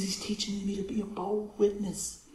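imitating a very soft-spoken little lady, hushed; tiny, hushed imitation of a gentle talker; real soft voice. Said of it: "she talks real soft".

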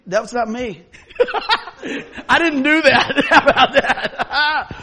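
high, loud, laughing protest; laughing denial. Pretend. Mock kid caught with missed trash, laughing loud denial. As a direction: deny it through the giggles.

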